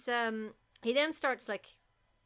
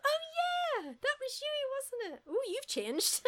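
much higher, slow mock-sweet recognition; mock Julia; slower than usual. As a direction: coo at him like an old fling. Voicing Julia mockingly.

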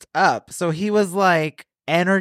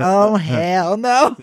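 drawn-out, higher-pitched mock outrage; emphatic refusal; 6 semitones higher, 4 dB louder, much slower than usual. Storyteller reacting to a married hookup, emphatic refusal. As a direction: stretch it into a big slow refusal.

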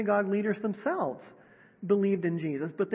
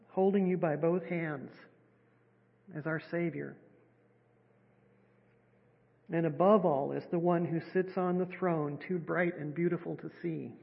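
guided meditation; 2 semitones lower than usual. Acted. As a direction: gently guide them into stillness.

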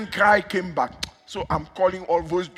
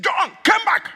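high, commanding summons; calling out; 7 dB louder, slower than usual. Retold or imitated. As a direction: call him back across a great distance. Preacher summons an absent husband.

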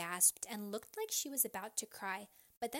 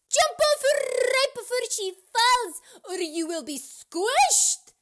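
very high, loud alarm; screamed warning; 18 dB louder than usual. A narrator's reading. Choler warning babies before teacher falls, high loud scream. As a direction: shriek the warning, stretching the end. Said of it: "Choler screamed".

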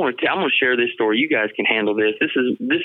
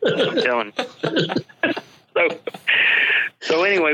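breaking into high, helpless laughter; laughing; 6 semitones higher, slower than usual. Real, not acted. Storyteller loses it describing what filled the bag, laughing. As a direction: crack up, dragging out the last words. Cracking up at own punch line.

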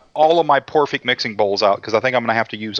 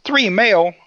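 high incredulous wife; 13 semitones higher than usual. Retold or imitated. Joke, wife voiced in disbelief.

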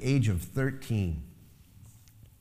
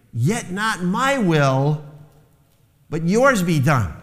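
anguished cry; 5 semitones higher, 4 dB louder, slower than usual. Retold or imitated. Jesus cries in agony.

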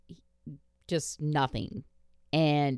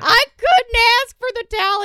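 much higher, louder whiny voice; high whiny excuse; 14 dB louder than usual. Retold or imitated. Mom telling kid why towel stayed, high whine. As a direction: whine it out, half laughing. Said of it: "And I'm like".

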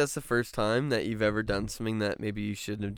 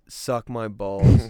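emphatic rant; 7 dB louder, much slower than usual. Acted. Ranting about slow drivers.

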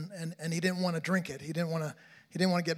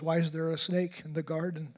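ordinary talk

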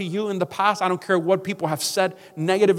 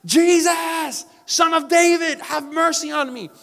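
high, loud desperate scream for mercy; desperate cry; 9 semitones higher, 5 dB louder, slower than usual. Retold or imitated. Blind beggar calling to Jesus as he passes, desperate scream. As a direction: cry out like it's your last chance. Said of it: "this may be my last chance".

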